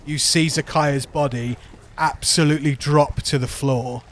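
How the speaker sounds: ordinary talk, nothing marked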